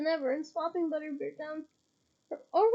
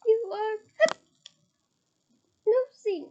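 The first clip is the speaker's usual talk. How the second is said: squeaky elf; 6 semitones higher, 7 dB louder than usual. A narrator's reading. Winky scolds Harry.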